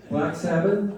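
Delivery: usual speaking voice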